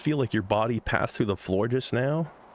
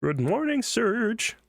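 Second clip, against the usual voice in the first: high, sing-song mom greeting; 13 semitones higher, slower than usual. Acted. Mother waking her son Serge, high and sing-song. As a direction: sweetly wake your son. Voices mom's wake-up call.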